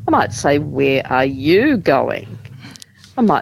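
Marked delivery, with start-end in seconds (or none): 0.6-2.4 "you" drawn out and made the peak; slow demo question weighted on "you"; stress on YOU. Shown as a demonstration. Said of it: "we might stress certain words".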